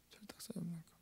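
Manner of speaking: very soft disapproving whisper; whispered reproach. Acted. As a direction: repeat the scolding in a bare whisper. Disciple's reproach repeated in whisper. (속삭이듯 나무라기)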